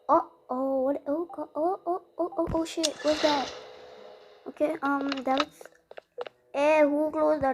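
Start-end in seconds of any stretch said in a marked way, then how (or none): 0.1-3.5 high, stuttering string of startled ohs; alarmed and higher-pitched. Real, not acted. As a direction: panic in short repeated bursts. Sudden alarm in horror game.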